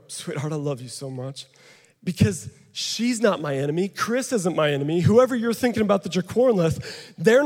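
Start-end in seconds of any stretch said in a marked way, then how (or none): 0.1-1.5 tender to wife. Retold or imitated. Telling wife he loves her.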